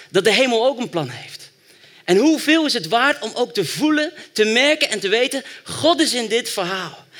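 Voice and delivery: high, loud and driving; fervent preaching. Acted. Preacher urging the church to feel God in the story, fervent. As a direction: rouse the congregation.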